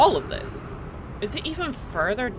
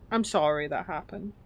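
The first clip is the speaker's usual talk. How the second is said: ordinary delivery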